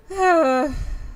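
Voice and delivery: long voiced groan of surrender; resigned sigh. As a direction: sigh as you give in. Giving in to friend.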